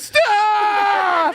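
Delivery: high, loud, crying shout of "Stop"; panicked shout. Retold or imitated. Terrified kid screams at the ride operator, high and loud. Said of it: "he goes".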